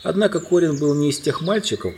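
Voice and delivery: plain reading voice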